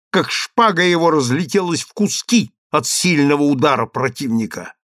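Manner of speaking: usual speaking voice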